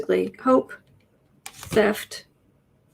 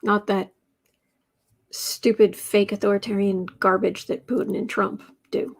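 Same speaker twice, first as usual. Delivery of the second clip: usual speaking voice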